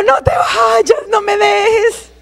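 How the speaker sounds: high, wailing cry, slowing on the last words; imitating her husband crying over her body; crying plea. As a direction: beg through tears not to be left. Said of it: "ahí sí estaba llorando".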